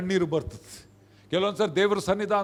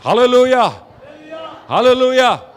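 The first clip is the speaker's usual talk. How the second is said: loud hallelujah call; exultant shout; 3 semitones higher, 7 dB louder than usual. Real, not acted. Preacher rouses congregation after resurrection story, exultant shouting.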